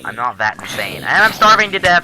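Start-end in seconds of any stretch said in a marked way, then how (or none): 1.0-2.0 high, loud hammed-up complaint; mock desperate yell. Player complaining about in-game hunger, mock desperate yell. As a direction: wail it out in mock despair. Exclaims over game peril.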